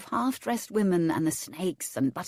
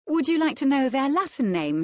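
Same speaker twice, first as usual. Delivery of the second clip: high, eager and bright; chirpy helpful offer; 8 semitones higher, 4 dB louder than usual. Acted. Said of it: "she offered brightly".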